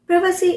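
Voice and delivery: privacy with a short first vowel, "PRIV-uh-see"; British pronunciation. Shown as a demonstration. Teacher labelling the variant, British pronunciation. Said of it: "a British pronunciation".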